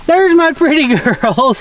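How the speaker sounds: high, slow, doting coo with a laugh; fond pet talk. Real, not acted. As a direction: gush over the donkeys like babies. Greeting her donkeys.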